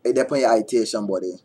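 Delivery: quick Georgetown Guyanese Creole warning; Georgetown accent. Shown as a demonstration. Demonstrating Guyanese dialect for viewers, Georgetown accent. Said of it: "say something in a Guyanese dialect".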